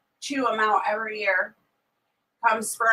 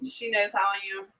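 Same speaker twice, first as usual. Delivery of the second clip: usual speaking voice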